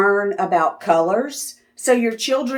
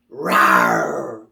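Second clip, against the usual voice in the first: a much lower growl like a friendly bear; playful bear growl. Pretend. Bear sound for children.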